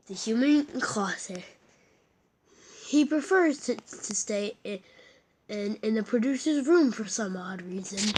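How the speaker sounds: solemn wildlife narrator voice; nature documentary. Acted. Kid narrates cats as documentary.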